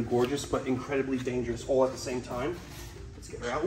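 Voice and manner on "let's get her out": whisper. Real, not acted. Handling dangerous cobra, hushed.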